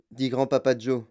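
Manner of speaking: ordinary delivery, nothing marked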